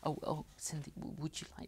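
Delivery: mock-nervous stammering invite, barely voiced. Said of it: "first of all, we're nervous".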